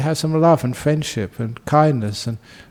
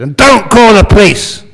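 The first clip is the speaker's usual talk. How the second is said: 1 threatening thief shout; 8 semitones higher, 10 dB louder than usual. Acted. Burglar warns before fleeing.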